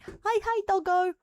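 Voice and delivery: high-pitched voice for the dog. Real, not acted. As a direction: coo at the puppy. Greeting her dog.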